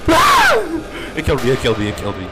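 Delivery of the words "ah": high shriek of fright; terrified scream. Real, not acted. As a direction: scream as you get caught. Killed by monster.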